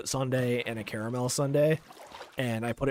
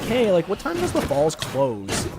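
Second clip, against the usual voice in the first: clueless customer; 5 semitones higher, 6 dB louder than usual. Retold or imitated. Acted clueless customer asking a question.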